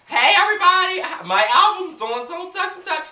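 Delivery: high, loud announcing; pretend announcer. Pretend star announcing her album to fans, high and loud. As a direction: announce your album to a crowd. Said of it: "I'm gonna be doing".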